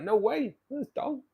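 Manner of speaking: a high, delighted double-take; excited disbelief. Retold or imitated. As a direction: squeak it out like you can't believe it's him. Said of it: "It's like".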